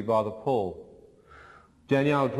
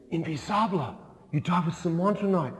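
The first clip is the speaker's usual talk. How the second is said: surprised friend voice; 5 semitones higher than usual. Retold or imitated. Friend reacts to forearm.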